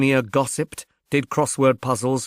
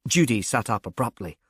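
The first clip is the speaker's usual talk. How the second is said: ordinary delivery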